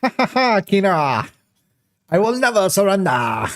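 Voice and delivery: high, hammed-up cartoon villain laugh; mustache twirling villain. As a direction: gloat like a mustache-twirling baddie. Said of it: "Mustache twirling".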